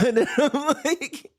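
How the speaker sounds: high, squeaky words through laughter; helpless high-pitched laughing exasperation. Retold or imitated. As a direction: crack up while protesting. Cracking up at the kid's answer.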